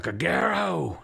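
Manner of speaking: high-pitched, drawn-out yell in character; loud yell. Acted. Game master as an annoyed pirate calling out, yelling loud. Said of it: "he yells real loud".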